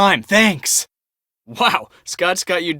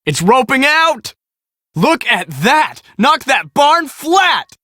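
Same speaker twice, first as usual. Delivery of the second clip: excited shout; 6 semitones higher, slower than usual. Acted. Storm chaser sees tornado hit barn.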